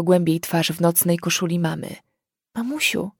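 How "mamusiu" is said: high, soft, stammering plea; pleading stammer. Acted. Ren begs her mother, high, soft and faltering. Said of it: "wyjąkała błagalnym głosem".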